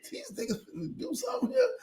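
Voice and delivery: nervous usher. Retold or imitated. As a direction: squeak it out like a scared security guard. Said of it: "the usher with his back to the motherfucker like".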